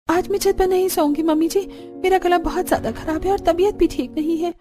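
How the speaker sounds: pleading daughter-in-law. Acted. (सास से मिन्नत करती बहू)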